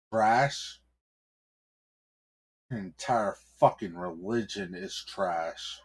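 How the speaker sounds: spat through gritted teeth. Acted. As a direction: squeeze it out through clenched teeth. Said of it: "he said with a gritted teeth".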